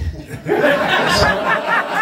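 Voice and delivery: high burst of laughter; real high laugh; big laugh. Real, not acted. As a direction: crack up loud. Laughing at own bit.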